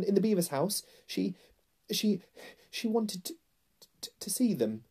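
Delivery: put-on high, stuttering frightened boy; terrified, halting explanation; trembling. A narrator's reading. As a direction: stammer nervously, pitched high, catching on words. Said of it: "trembling so that he could hardly speak".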